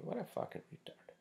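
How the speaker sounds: breathy high whisper, half to himself; incredulous whisper. Real, not acted. Vlogger venting about a rude client, high disbelieving whisper.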